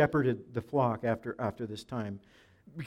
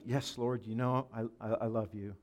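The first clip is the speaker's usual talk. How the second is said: softer, hesitant answer as Peter; subdued reply; 3 semitones lower, 8 dB quieter than usual. Acted. Peter answering Jesus a second time, subdued. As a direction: drop your voice, falter slightly on "I".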